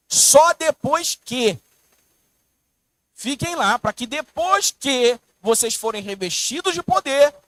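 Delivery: slow, loud, high preaching; impassioned preaching. Real, not acted. Preacher urging the congregation, slow and loud. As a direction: hammer each word home.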